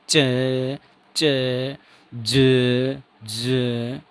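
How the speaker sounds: "च" and "ज" with the air let out gently; slow-release demo. Shown as a demonstration. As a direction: model "च, च, ज, ज" slowly released. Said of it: "आराम से रिलीज होगी".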